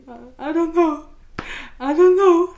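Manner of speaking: hammed-up tearful cluelessness; acted wailing. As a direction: wail it helplessly to the policeman. Acts out tearful, lost reply to policeman.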